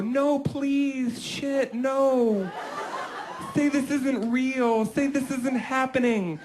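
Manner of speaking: high, hammy fake terror; mock frightened. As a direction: overact a scared victim. Wife's hammy fake terror again.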